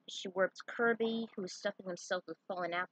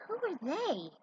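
puzzled dinosaur; 9 semitones higher than usual. Acted. Confused dinosaur wonders.

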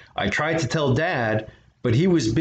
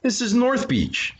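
put-on higher, slow childlike reply; young boy; 12 semitones higher than usual. A narrator's reading. Boy telling the pirate where he landed. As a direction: answer like a polite little boy.

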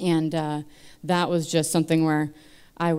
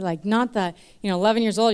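ordinary talk, nothing marked

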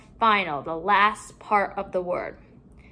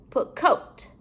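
ordinary delivery

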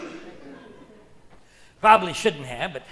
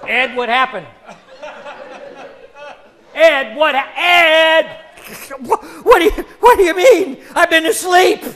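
put-on confused old man; 5 semitones higher, 6 dB louder than usual. Ed wakes confused.

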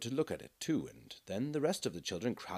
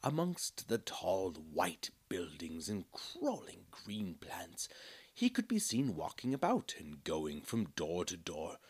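hushed storybook whisper, slow at first; soft whispered storytelling; whispered narration; 3 semitones lower, 5 dB quieter than usual. A narrator's reading. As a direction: breathe the opening lines like a secret.

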